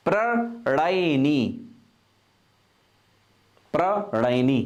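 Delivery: slow dictation. Shown as a demonstration. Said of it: "अगला है प्रणयनी".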